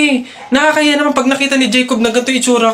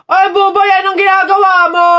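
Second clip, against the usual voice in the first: loud, higher, fast scolding yell; shouting at a friend; 6 semitones higher, 4 dB louder than usual. Acted. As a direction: call him out across the yard. Kid yells at friend. (sigaw na pagsaway sa kaibigan)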